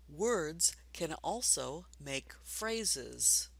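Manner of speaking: put-on slowly, each word spaced for children; slow read-along. Shown as a demonstration. Teacher reading slowly with children, slow and clear. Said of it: "Read with me together".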